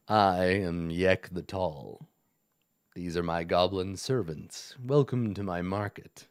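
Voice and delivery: low, resonant villain baritone; a hint of a deep goblin king. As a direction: roll it out low like a smug lord. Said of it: "resonant baritone voice".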